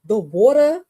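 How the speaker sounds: imitated East Coast accent, rising at the end. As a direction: relish "water" as an East Coaster says it. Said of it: "I love an East Coast accent".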